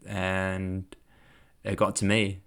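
ordinary talk

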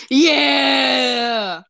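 long, high shouted cheer; excited cheer. Real, not acted. Player cheering friend's idea, long high shout. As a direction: holler it out and hold it.

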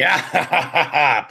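lightly hammed-up mad scientist laugh; maniacal laugh. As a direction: ham up an evil ha ha ha. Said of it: "gives a maniacal laugh".

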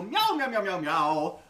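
rapid mock cat meows, falling in pitch; unhinged meowing. Fan imitates Ian McKellen as a cat, unhinged meowing. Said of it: "and be unhinged".

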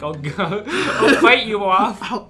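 laughing through a mock threat; cracking up mid-threat, slow and loud. Swinging mirror at imagined things.

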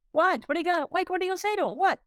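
high, puzzled quoted wife; wife's puzzled voice. Retold or imitated. Retelling his wife Debbie's baffled questions, high and puzzled. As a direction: raise the pitch to play the wife.

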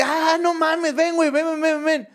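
high, eager come-along; excited urging. Retold or imitated. Agent taking the comedian to his boss, excited. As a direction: urge him along in a high voice. Agente lo invita con su jefe.

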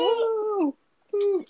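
high whooping cheer for the guest; excited woo. Real, not acted. Host cheering the first ever special guest, high whoop.

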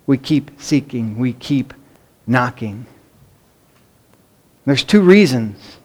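ordinary delivery

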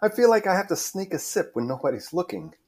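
high-pitched, amused fellow feeling; Melanie, amused. Acted. Melanie's reply in read script.

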